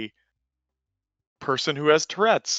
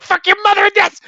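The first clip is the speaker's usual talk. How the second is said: high shouted curse, then yes; imitated tic shout; 7 dB louder, faster than usual. Customer with Tourette's blurting a curse while agreeing. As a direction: yell it out high and sudden. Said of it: "the guy's just like".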